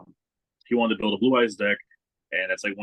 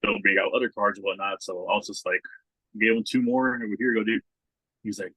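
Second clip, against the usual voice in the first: ordinary talk, nothing marked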